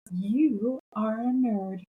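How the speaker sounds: slowly, deliberately pronounced; slow teasing verdict. Retold or imitated. Boss teasing her about the blazer, slow and deliberate. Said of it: "He goes".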